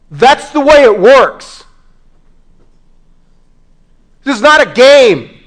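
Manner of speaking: high, loud, impassioned sermon; full-force preaching. Real, not acted. Preacher warning congregation they will face God, full-force preaching. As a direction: preach it hard, pitch and volume up.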